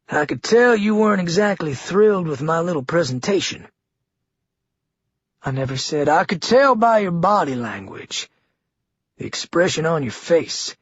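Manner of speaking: put-on governor needling Lily in a Georgia accent; Georgia twang. A narrator's reading. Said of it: "in his Georgia twang".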